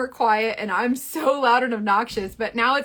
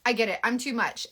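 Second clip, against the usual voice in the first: ordinary talk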